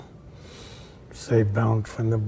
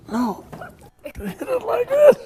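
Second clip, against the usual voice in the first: much higher, cracking, laughing student voice; a player at the end of his rope, half laughing; exasperated student; 6 dB louder than usual. Retold or imitated. Frustrated tennis student to coach, cracking high with laughter.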